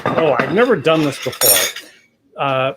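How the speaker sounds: ordinary talk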